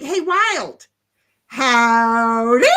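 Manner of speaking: drawled cowboy howdy; hammed-up country hello; mock Tammy greeting. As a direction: greet like a folksy ranch hand. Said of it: "Tammy's like".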